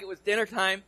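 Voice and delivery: normal talking voice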